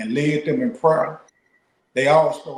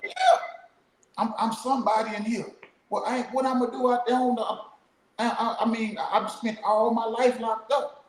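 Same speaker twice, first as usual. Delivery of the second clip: higher, breaking voice with a sob; imitated sobbing; 9 semitones higher than usual. Retelling a friend crying about leaving prison, imitated with a breaking voice. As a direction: let the voice crack into a cry as you retell him. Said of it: "crying in tears".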